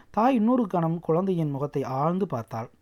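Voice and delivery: usual speaking voice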